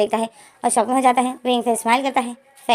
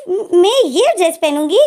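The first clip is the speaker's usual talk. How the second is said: shocked wife; 6 semitones higher, 3 dB louder, slower than usual. Retold or imitated. Wife shocked by short dress.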